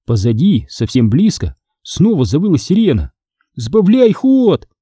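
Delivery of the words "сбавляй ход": high, slow wailing plea. Acted. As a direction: wail at the car to slow down. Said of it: "запричитал я". (причитая)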